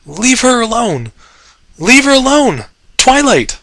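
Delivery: desperate high shout. Acted. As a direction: scream for her to be let go. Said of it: "he called".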